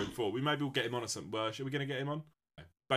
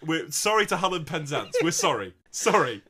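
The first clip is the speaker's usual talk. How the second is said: loud, higher pleading; mock apology; 7 semitones higher, 8 dB louder than usual. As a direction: apologise emphatically over the laughs. Mock apology to offended listeners.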